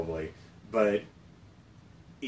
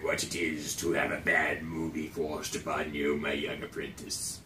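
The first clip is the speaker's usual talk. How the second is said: evil emperor voice; 6 semitones lower, 6 dB quieter than usual. Pretend. Sith master parody.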